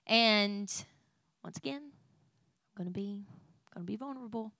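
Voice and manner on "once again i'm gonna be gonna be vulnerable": real hushed whisper before a confession. Real, not acted. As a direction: whisper it, almost no voice. Confessing something vulnerable.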